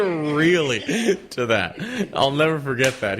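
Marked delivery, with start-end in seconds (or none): 0.0-0.8 flabbergasted, higher drawn-out word; raised-pitch imitation of astonishment; James. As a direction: mimic stunned disbelief through laughter. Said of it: "He said it was just completely flabbergasted".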